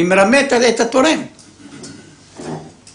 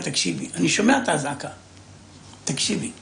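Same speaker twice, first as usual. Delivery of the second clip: calm hush; 8 dB quieter than usual. Retold or imitated. Husband calming panicked wife.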